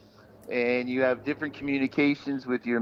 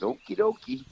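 cheery okie dokie; 9 semitones higher than usual. Retold or imitated. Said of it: "And I said".

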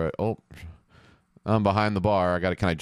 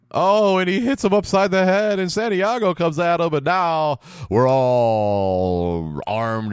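excited; 9 semitones higher, 5 dB louder, slower than usual. Real, not acted. Sudden fight breaks out in game.

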